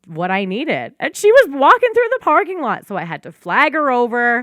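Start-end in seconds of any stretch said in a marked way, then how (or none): 1.0-2.9 high, loud, excited storytelling; excited high retelling. Retold or imitated. Retelling to her podcast cohost, high and excited. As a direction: squeal out the funny twist.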